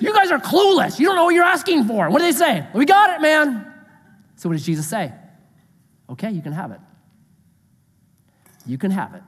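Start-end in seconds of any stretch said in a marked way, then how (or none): 0.0-2.1 high, raised-voice exasperated shout; shouted rebuke. Acted. Jesus telling disciples they are clueless, shouted rebuke. 2.7-3.7 higher, hammed-up overconfidence; cocky assurance. Disciples answering Jesus' warning, mock cocky assurance. 6.1-9.2 soft, low, ominous granting; resigned consent. Acted. Jesus granting the disciples' request, quiet resigned consent.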